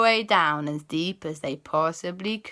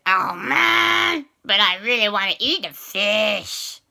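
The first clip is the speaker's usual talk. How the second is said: whiny reluctance; 3 semitones higher, 5 dB louder, slower than usual. Acted. Boys reluctant to give fish.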